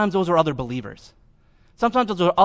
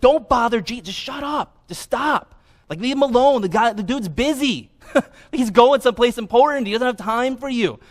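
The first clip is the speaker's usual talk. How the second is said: high, impatient telling-off; scolding crowd; 6 semitones higher than usual. Retold or imitated. Crowd telling the blind man off, high and impatient. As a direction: shoo away a pest, pitched up. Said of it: "it's what they say to them".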